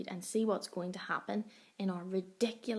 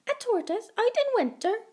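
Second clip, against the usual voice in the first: much higher, fast chirpy voice; cheeping bird; 12 semitones higher, 6 dB louder, faster than usual. A narrator's reading. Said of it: "cheeped the bird".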